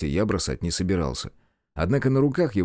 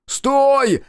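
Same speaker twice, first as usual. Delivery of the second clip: high, loud desperate stop command; full-voiced high yell; shout; 7 dB louder than usual. A narrator's reading. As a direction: scream at him to halt. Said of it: "закричал я".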